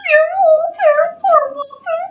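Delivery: high cooing sympathy; mock pity. Parent cooing over poor Walter at the end. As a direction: coo over the dog.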